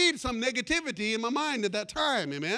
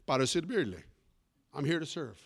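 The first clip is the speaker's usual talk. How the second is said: calm helpful stranger; 7 semitones lower than usual. Retold or imitated. Man answers flustered pastor who asks to help, low and calm. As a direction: play him low and composed, unruffled.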